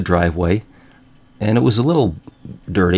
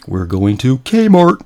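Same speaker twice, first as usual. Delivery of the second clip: slow, loud singsong, pitched up; brother's annoying catchphrase; 5 semitones higher, 4 dB louder, slower than usual. Retold or imitated. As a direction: stretch it into an irritating little chant. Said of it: "and he goes".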